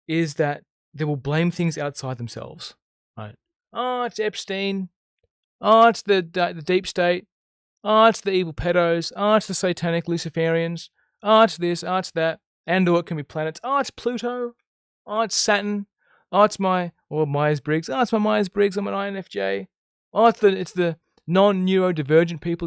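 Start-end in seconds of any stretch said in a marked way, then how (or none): 3.7-12.4 mock complaint, each "Oh" punched; mocking blame-shifters. Mocking those who blame others. 13.6-16.9 higher mock-complaint voice; each "Oh, it's" sung out mockingly; mocking blame-shifters. Mocking those who blame others. 17.9-22.7 higher mock whinge; each "Oh" leaned on in mock complaint; mocking blame-shifters. Mocking those who blame others.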